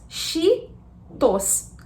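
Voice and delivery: slow Cheetos with "sh" and Portuguese T; Brazilian Cheetos. Shown as a demonstration. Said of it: "Em português, nós falamos".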